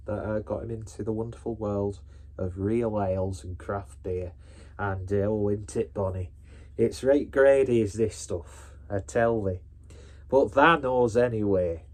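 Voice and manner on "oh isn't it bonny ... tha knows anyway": hammed-up Yorkshire dialect; terrible Yorkshire accent. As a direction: put on a badly faked Yorkshire accent. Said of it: "that's a terrible yet stereotypical attempt at doing a, a Yorkshire accent".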